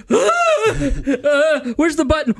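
high, laughing panic; panicked yelp. Retold or imitated. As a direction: yelp and scramble for the button. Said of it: "I went".

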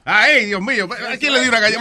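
usual speaking voice

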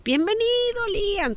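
high, lilting welcome greeting; high sing-song welcome to her arriving son. Retold or imitated. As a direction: read it out like a cheerful banner. Family welcome banner for son.

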